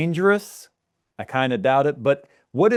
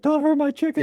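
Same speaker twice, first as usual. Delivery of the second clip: imitating a crying man, pitched way up; mock sob, much higher voice; high whiny plea. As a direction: plead for the chicken in a high wail. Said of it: "He's like".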